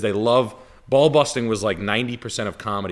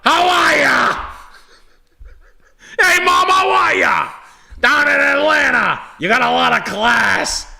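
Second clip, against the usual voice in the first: very high, drawn-out shout; hollered greeting; 7 dB louder, slower than usual. Pretend. Host greeting a listener's mom in Atlanta, mock-friendly holler. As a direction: call out as if to someone far away.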